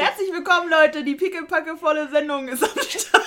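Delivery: loud, hyped podcast opener; excited welcome. Real, not acted. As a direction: greet them with a big buzz. Hyped show opening.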